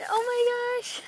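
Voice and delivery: high delighted squeal of surprise; a sudden thrilled squeal at what's inside; excited gasp. Real, not acted. Unboxing a gift from a friend, a high thrilled squeal.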